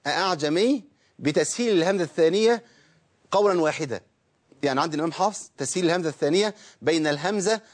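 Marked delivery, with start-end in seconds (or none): none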